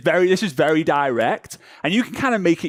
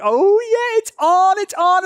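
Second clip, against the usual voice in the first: high, loud, thrilled self-hype; excited anticipation; 4 dB louder than usual. Acted. Hopeful seller watching the typing dots start, high and excited. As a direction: celebrate as if it's finally happening. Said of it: "you're like".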